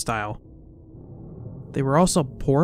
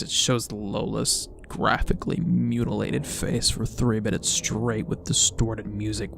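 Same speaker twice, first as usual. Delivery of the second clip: normal talking voice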